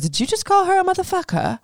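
imitating a stunned gasp of disbelief; shocked. Imitating Lisa shocked he called her a motherfucker. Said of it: "Lisa points it out and goes".

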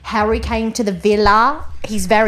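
slow, hammed-up British islander; put-on British villa talk; mock British accent. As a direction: play a British dating-show contestant, drawn out. Acting British Love Island contestants.

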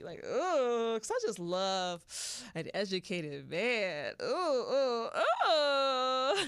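hammed-up swooning oohs; playful excitement. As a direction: squeal with mock delight. Swooning over an educated man.

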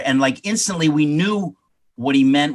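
usual speaking voice

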